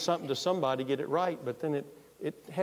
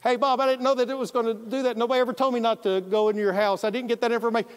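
rushed apology; 7 semitones higher, 5 dB louder, faster than usual. Retold or imitated. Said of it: "I'm gonna say it as fast as I can".

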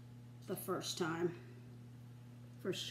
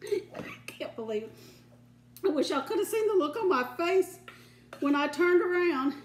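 ordinary talk

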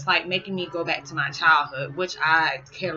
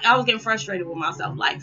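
ordinary talk

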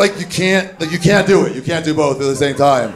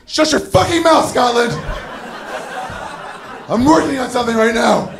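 much higher straining snap at the Scot; strained outburst; 8 semitones higher than usual. Retold or imitated. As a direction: lash out while holding it in. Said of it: "And I'm like".

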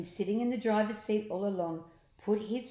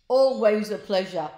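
Slime voice; 2 semitones higher, 10 dB louder than usual. Acted. Slime replying.

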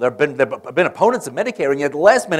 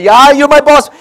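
higher and louder, gushing with delight; jubilant; 11 semitones higher, 13 dB louder than usual. Retold or imitated. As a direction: gush over the boss like an overjoyed senator. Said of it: "Long is jubilant".

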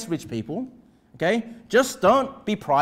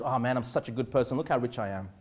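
much lower and softer, self-satisfied mock boast; smug rich man; 10 semitones lower, 9 dB quieter than usual. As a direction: mutter it low like a smug rich man admiring himself.

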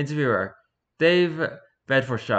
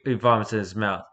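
usual speaking voice

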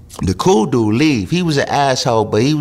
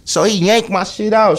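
ordinary delivery

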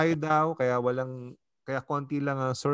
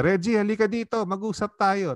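raised, stern call to come over; higher, louder angry aunt calling him over; 9 semitones higher, 3 dB louder than usual. Retold or imitated. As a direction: summon him like a cross aunt about to scold. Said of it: "galit na galit si Tita Fe".

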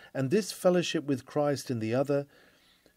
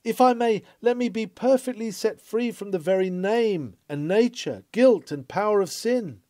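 eager plea; 7 semitones higher, 4 dB louder than usual. Retold or imitated. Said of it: "would you not say".

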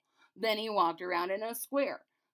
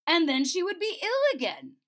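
high, loud angry outburst; the king scolds, voice rising; 9 semitones higher, 8 dB louder than usual. A narrator's reading. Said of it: "the king very angry".

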